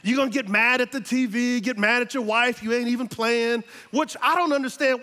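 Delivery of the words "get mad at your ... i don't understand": usual speaking voice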